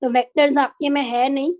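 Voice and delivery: ordinary talk, nothing marked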